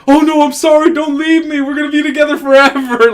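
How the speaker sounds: hammed-up needy girlfriend; whiny clingy girlfriend. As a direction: beg him to stay in a mock girly voice. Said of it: "she got all".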